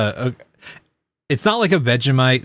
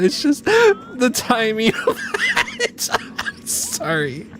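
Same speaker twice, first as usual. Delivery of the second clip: laughing apology; 12 semitones higher, slower than usual. Real, not acted.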